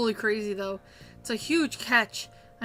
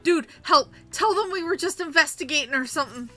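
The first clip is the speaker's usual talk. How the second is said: whiny, near-crying call for help; panicked plea; 4 dB louder than usual. Acted. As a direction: beg a friend to rescue you. Cornered by drag queens, pleading.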